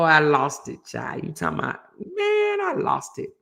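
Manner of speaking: ordinary talk, nothing marked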